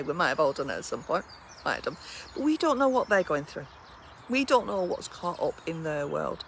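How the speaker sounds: ordinary talk, nothing marked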